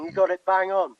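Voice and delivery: ordinary talk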